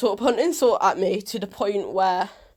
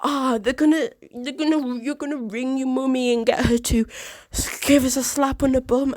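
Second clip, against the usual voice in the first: higher, sneering mock voice; 4 semitones higher than usual. Bully girl mocking the narrator's threat, sneering and higher. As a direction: taunt like a bully making fun, pitched up. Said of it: "she went".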